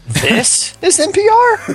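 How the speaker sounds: "This is N P R?" rising high and slow like a question; asking the station name as if unsure; question mark. Shown as a demonstration. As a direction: end "N P R?" on a big unsure rise. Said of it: "Kinda like aRon Burgundy question mark".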